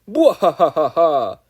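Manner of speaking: slow, loud villain laugh; evil laugh. Acted. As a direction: demonstrate a proper evil laugh. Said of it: "Here's how you do it".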